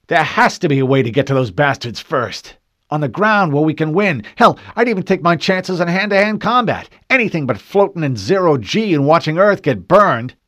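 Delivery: high, loud, furious outburst; barely checked rage. A narrator's reading. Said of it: "His fists trembled with barely checked rage".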